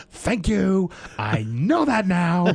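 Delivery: high, over-the-top outburst, slowing on the second line; Nick Cage. Retold or imitated. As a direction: imitate a crazed light-bulb moment. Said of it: "just that kind of his delivery of those lines".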